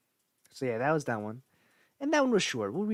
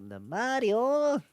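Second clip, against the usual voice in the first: high, slow, louder Luigi calling out; Luigi's Mario; 10 semitones higher, 6 dB louder, much slower than usual. Retold or imitated. Doing how Luigi shouts for Mario. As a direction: draw out the name like the cartoon brother. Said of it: "I can do his Mario".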